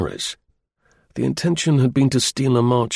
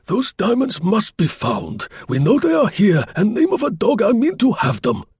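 commanding; 12 semitones higher than usual. A narrator's reading. Gourdon ordering his men, high and insistent. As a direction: hammer home that the diamonds are here. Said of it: "he commanded".

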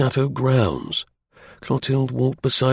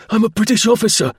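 high, loud protest; raised, high-pitched insistence; desperate shout; 12 semitones higher, 5 dB louder than usual. Acted. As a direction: shout it out to be heard. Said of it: "shouted Martin in English".

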